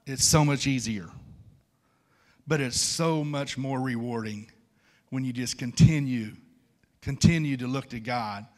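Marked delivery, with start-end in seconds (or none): none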